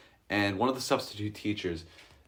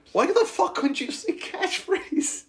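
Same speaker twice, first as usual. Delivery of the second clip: hysterical protest. Retold or imitated. Student to teacher over a fancy word, squealing protest. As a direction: shriek it in laughing exasperation.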